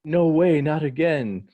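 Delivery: loud, high acted protest; dismayed cry. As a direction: cry out in mock dread. Nami's acted dismayed reaction.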